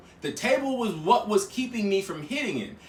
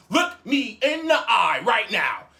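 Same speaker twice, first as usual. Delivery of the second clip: each word slow and hard-hit; insist, spacing out the command; acted-out slow, forceful demand; stern slow order; 2 semitones higher, 3 dB louder, slower than usual. Repeated order, slower and harder.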